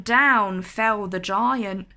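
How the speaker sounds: ordinary delivery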